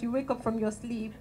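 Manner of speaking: ordinary talk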